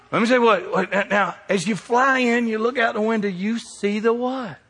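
high, loud preaching build; preaching at full force. Acted. Pastor to the congregation, fervent preaching. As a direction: preach it out with rising fire.

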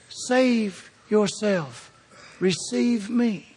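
slow, soft, pleading; slow earnest plea. Acted. Preacher voices Jesus inviting people, slow and tender. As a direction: draw each word out gently.

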